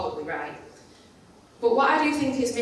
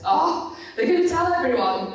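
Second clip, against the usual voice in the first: panicked thought; 7 semitones higher, 5 dB louder than usual. Retold or imitated.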